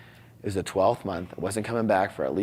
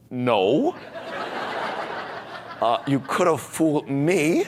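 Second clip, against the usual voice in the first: louder, laughing through a wry comeback; laughing retort; 4 semitones higher, 3 dB louder, slower than usual. Retold or imitated. Answering his crying father, laughing wryly. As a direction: laugh it off, half disbelieving.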